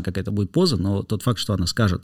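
ordinary delivery

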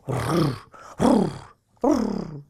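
three rolled growls; snarling rolled r's; dog growls. A narrator's reading. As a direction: growl like an angry dog, in bursts. Dog threatens the cat.

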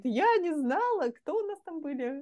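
a touch of naive amazement; imitating Sonya. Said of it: "и она говорит". (удивлённо, подражая Соне)

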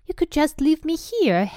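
high, eager hopeful plea. A narrator's reading. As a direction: put it in hopefully, voice lifted. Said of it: "Harry put in hopefully".

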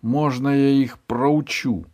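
high, loud, slow eager plea; itching to fight. Acted. (нетерпеливо)

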